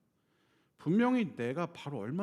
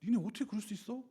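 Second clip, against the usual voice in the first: Jesus angry; 4 semitones higher than usual. Retold or imitated. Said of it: "하고 화를 내시는 거예요".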